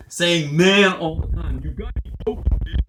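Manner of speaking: slow Southern drawl on man; hammed-up Southern accent. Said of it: "Man comes very much into this".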